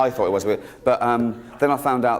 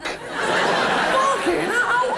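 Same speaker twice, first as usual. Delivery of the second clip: high, slow stunned swearing, laughing; awestruck; much slower than usual. Acted. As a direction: drag out the swear in mock awe. Awed at creation in dark.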